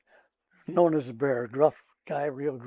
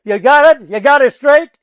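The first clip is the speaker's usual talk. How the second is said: loud, high-pitched demand; retold screaming; 8 semitones higher, 13 dB louder than usual. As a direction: yell it right in his face. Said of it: "and I'm just screaming".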